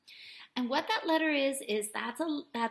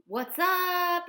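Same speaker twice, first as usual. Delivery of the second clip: casual what's up; 6 semitones higher, 5 dB louder than usual. Shown as a demonstration. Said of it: "You can start it with".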